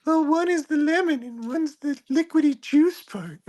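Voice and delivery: very high-pitched cartoon character; tiny high character voice; Bitsy voice. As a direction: put on a high squeaky little voice. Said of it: "a second to do Bitsy".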